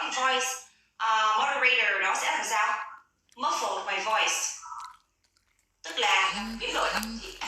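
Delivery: ordinary delivery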